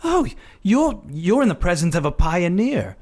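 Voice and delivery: fawning mock introduction of Doctor Hart; hammed-up introduction. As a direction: present her like a celebrity. Hamming Watson introducing the doctor.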